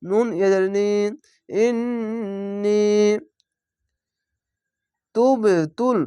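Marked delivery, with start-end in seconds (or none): 1.5-2.8 ইন্নী very slow, long humming "nn", then long "nee"; wajib ghunnah. Shown as a demonstration. Teacher showing ghunnah, ইন্নী with long nasal "nn". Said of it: "এখানে ওয়াজিব গুন্না". 5.1-6.0 তুব তুল with a bouncing push on the "b"; qalqalah. Shown as a demonstration. Teacher demonstrating qalqalah, তুব তুল with echoing "b". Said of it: "কলকলা ধাক্কা মেরে নিচে sound আসবে".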